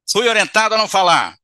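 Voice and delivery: loud, high, defiant retort; angry spirit. Acted. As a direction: throw it back angrily.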